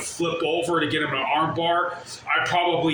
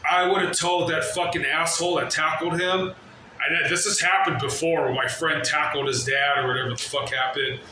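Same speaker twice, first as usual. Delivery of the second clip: ordinary delivery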